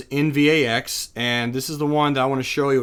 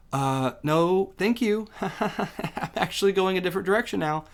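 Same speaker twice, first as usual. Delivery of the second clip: a light, higher mock voice for the stock, laughing on the last line; sassy stock voice; 6 semitones higher, 4 dB quieter than usual. Teacher voices the stock turning down traders, lightly mocking and laughing.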